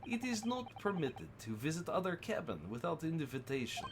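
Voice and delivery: lightly put-on Russian accent, broken English; a hint of a gruff Russian sailor guarding the cabins. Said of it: "same Russian voice".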